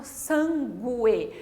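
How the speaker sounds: sangue said san-gu-e, the u voiced; U wrongly sounded. Shown as a demonstration. Teacher showing how we don't say sangue, u sounded. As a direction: pull sangue apart so the u is heard. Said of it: "eu não falo sangue".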